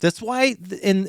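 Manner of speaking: usual speaking voice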